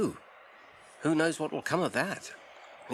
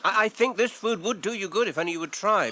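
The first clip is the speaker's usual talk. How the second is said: pleading; 8 semitones higher, 7 dB louder than usual. Acted. Frodo pleading with Gollum.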